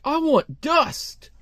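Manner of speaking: high, drawn-out fanatic voice; hammed-up zealot's declaration; crazed intruder. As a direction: stretch it slowly, way up high. Crazed intruder's dramatic answer.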